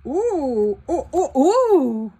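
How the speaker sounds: loud, slow, laughing cries of joy; delighted exclamation. Real, not acted. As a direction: squeal over a lovely find. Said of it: "le bruit que j'ai fait". (cri de joie en riant)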